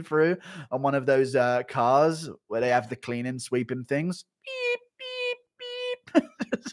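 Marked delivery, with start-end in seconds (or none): 4.4-6.6 high-pitched reversing-cart beeps; imitated vehicle beeps. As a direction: beep like a little cleaning cart. Imitates a cleaning cart's warning beeps.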